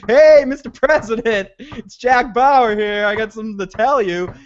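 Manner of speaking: high, loud hammed-up hello at the door; mock calling out. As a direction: holler a cheery announcement, stretching the name.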